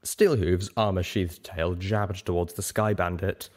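ordinary delivery

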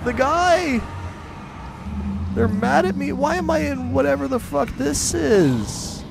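very high, loud, panicked cries; panicked squeal. Real, not acted. Gamer squealing in panic as angry mobs chase him. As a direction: squeal in real alarm as the mobs turn on him.

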